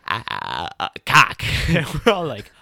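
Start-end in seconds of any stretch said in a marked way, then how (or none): 0.1-1.4 stuttering ahs, then a loud burst on cock; mock stammer. Mocking a classmate fumbling a made-up word, loud blurt. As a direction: imitate a flustered kid blurting a word. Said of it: "you're like".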